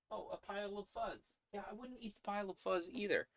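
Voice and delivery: sing-song pet voice; 1 high pet talk. Real, not acted. Talking to sniffing cat.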